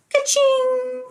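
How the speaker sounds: high sing-song ka-ching; jingle effect. Acted. Storyteller makes a bell sound for the kids, high and playful. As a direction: ring it out like a cash register.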